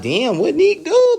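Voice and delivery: high, loud, stretched-out disbelief; stunned reaction. Retold or imitated. Reacting to the boss threatening Neek, loudly astonished. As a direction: cry it out in drawn-out amazement.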